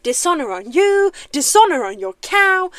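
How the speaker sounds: loud, theatrical dragon proclamation; Mushu impersonation. Acted. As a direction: declare the dishonor with grand drama. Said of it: "Mushu's monologue from Disney".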